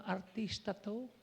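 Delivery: ordinary talk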